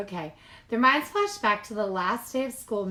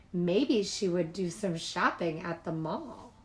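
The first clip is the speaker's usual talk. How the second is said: ordinary delivery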